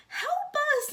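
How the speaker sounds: high frantic cry for help; high, loud call for help. Acted. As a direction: yell for help, panicked. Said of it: "Missus Watson called".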